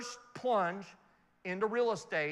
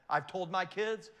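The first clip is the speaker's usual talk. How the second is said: ordinary talk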